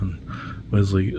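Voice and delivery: usual speaking voice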